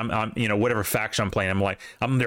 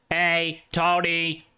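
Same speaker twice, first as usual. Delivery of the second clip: a light hammed-up New York Italian voice; Italian-American accent; 6 semitones higher, much slower than usual. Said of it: "offensive Italian accent".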